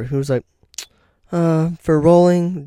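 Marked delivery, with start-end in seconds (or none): 1.3-2.7 lightly mocked cop, higher, louder, drawn out; imitated hesitant cop. Cop explains the stop to the driver, mock hesitant. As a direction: stretch it out slowly as a mock officer's excuse. Acting the cop stalling on an answer.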